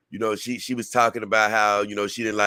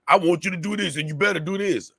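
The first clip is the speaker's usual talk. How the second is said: high, pushy mock demand; bossy viewer; 8 semitones higher, faster than usual. Vlogger imitating a viewer demanding shows, mock bossy. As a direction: play a bully making demands, a notch up. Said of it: "I won't be bullied".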